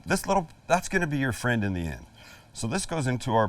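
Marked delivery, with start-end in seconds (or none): none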